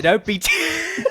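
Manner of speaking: high, slow, laughing mock British; laughing British accent attempt. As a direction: giggle through a British put-on. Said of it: "more British accent".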